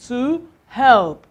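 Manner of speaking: ordinary talk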